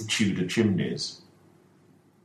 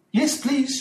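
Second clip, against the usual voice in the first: higher, lightly voiced sick lady; weak Mrs Ferguson. Mrs Ferguson accepting the doctor, a lightly voiced frail yes. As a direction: answer faintly from the sickbed.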